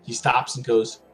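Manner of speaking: ordinary delivery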